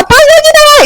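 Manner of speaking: very high cry of treason; acted high-pitched outburst; outraged shouting. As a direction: shriek the accusation like a furious queen. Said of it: "이렇게 고함을 질렀지만".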